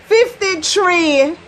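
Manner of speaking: slow, loud "Fifty-three"; slow demo. Shown as a demonstration. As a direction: spell it out slowly. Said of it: "demo of the number".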